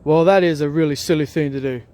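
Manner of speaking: loud, hammed-up telling-off; mock angry. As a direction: scold him loudly as a skit character. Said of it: "instead of just get angry at Freddy".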